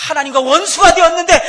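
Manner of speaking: high loud cry from the pulpit; full-throated pulpit cry; preaching shout. Acted. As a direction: shout it with everything. Preacher cries out.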